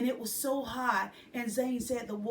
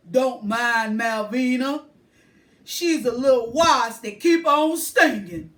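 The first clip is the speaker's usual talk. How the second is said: louder, easygoing man; deeper man's voice; 11 dB louder than usual. A narrator's reading. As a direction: shrug her off like an amused grown man. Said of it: "much deeper".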